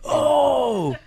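high, loud drawn-out cry; mock shoutcast holler; shoutcaster yell. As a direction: yell it out like a hyped-up commentator. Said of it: "Yeah, I just yelled".

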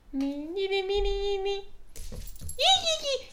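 high squeaky pet voice. Real, not acted. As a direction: coo at the pet. Talking to the pet.

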